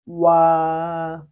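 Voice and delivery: loud, held wa for students to copy; Chinese wa. Shown as a demonstration. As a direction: model wa clearly. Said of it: "replace the wu sound with wu".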